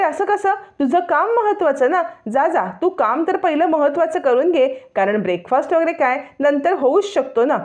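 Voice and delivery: high, sweetly taunting. Acted. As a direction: needle her with fake concern. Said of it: "मुद्दाम रोहिणी टोमणा मारते". (रोहिणी नैनाला मुद्दाम टोमणा मारते, उंच उपहासाने)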